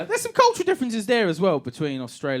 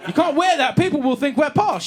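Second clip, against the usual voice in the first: alarmed husband; 9 semitones higher, 4 dB louder than usual. Retold or imitated. Acted argument with wife.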